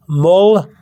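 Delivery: "moldeada" sounded out in parts; demonstrated pronunciation. Shown as a demonstration. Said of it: "if I can pronounce it right for you".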